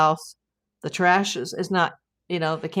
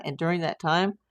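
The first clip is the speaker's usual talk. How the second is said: ordinary talk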